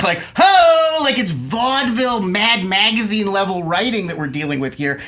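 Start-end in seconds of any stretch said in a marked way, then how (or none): 0.3-1.0 mock rimshot whoop. Real, not acted. Mocking a bad pun.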